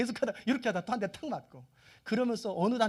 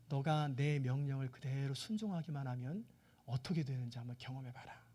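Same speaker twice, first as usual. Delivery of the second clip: low, quiet and measured; gentle divine instruction; 6 semitones lower, 7 dB quieter than usual. Acted. God teaching Israel to trust his command, gently and low. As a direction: speak softly as God teaching his people.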